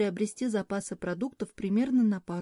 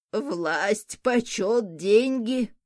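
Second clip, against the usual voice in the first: ordinary delivery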